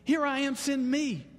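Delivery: high-pitched, eager offer of oneself; bold volunteering. Acted. Preacher showing Isaiah's shift from groveling, bold and eager. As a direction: burst out boldly, pitched well up. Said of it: "titanic shift between, between, uh, humility and groveling".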